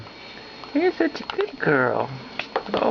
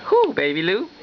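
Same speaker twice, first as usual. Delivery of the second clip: ordinary talk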